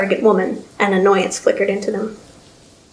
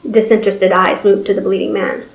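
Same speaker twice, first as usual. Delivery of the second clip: ordinary delivery, nothing marked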